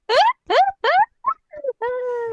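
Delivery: loud high-pitched laugh. Real, not acted. Laughing at inside joke.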